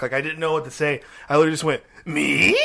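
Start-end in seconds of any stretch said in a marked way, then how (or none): 2.1-2.6 mock-startled squeak; taken aback. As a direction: squeak it out as if caught off guard. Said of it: "I was so taken aback".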